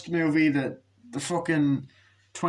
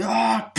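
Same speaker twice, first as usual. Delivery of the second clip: short high "ah" cry; mock falling yelp; 9 semitones higher, 4 dB louder than usual. Bullies throw him over, mock falling yelp.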